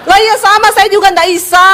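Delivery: high-pitched exasperated retort; high-ending Sulawesi tone. Retold or imitated. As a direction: fire back with pitch held high to the end. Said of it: "nadanya belakangnya tinggi".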